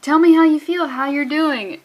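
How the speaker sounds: loud, high, pushy question; hammed-up nagging friend; loud and high prying ask; mock pushy demand. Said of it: "she's like".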